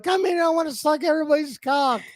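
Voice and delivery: playful falsetto quote; mock woman's voice. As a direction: squeal it like the drunk girl. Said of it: "she was like".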